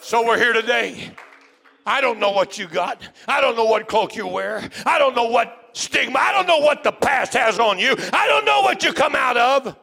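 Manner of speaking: full-force preacher; fiery preaching. Acted. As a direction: drive it home to the congregation. Preaching over music.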